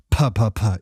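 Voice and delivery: loud plosive puh demo. Shown as a demonstration. Showing close-mic pops to viewers, loud puh bursts. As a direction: spit the P's right into the mic. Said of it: "if you go real close".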